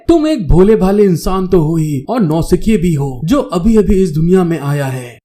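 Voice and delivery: ordinary delivery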